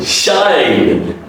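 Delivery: 'shied' drawn out to a voiced D; long falling vowel in 'shied'; voiced D ending. Shown as a demonstration. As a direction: say 'shied' as shy-d.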